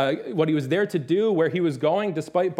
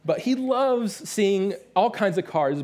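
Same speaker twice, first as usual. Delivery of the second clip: normal talking voice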